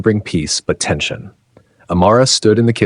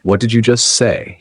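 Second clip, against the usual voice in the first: ordinary delivery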